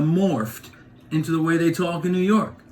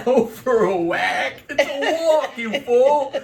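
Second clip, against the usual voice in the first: high, laughing, scornful correction; laughing mock scold; 10 semitones higher, slower than usual. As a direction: tease the Boston guy like a kid. Kid mocking Boston speech.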